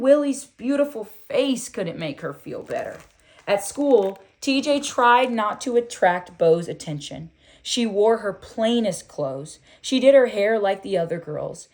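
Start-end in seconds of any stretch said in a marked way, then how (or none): none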